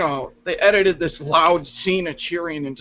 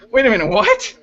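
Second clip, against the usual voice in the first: surprised; 7 semitones higher, 5 dB louder than usual. Retold or imitated. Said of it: "I'm like".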